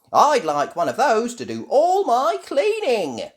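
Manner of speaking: high, loud character voice; Nanny Plum's wish. A narrator's reading. Nanny Plum admiring the robot, high loud voice.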